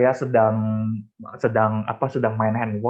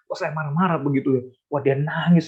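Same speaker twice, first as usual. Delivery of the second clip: ordinary delivery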